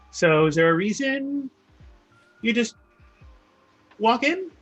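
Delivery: lightly voiced blacksmith, pitch climbing into the question. Blacksmith asks the stranger why he walked in, halting and questioning.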